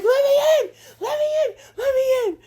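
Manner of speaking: high frantic yell to be let in; desperate pleading. Retold or imitated. Stranded person yelling at the car to be let in, frantic. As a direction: pound the car and scream let me in.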